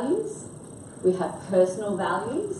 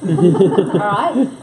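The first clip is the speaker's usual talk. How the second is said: ordinary delivery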